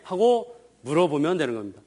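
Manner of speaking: normal talking voice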